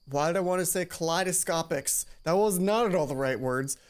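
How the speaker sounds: ordinary delivery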